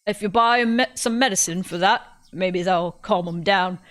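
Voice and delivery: put-on a self-admitted terrible British accent; bad British accent. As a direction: captor guesses constipation, in a bad British accent. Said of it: "my British accent is incredibly bad".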